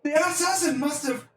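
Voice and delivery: very high, hammed-up panicky wail; high-pitched frantic underling; distraught acolyte. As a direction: cry out in shrieky mock horror. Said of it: "one of the acolytes goes".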